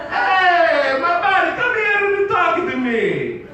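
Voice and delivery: high, loud Italian holler, drawn out; hammed-up Italian Jesus shouting; full-on Italian accent. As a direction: call a buddy over in a big Italian voice. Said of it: "Jesus would be full-on Italian".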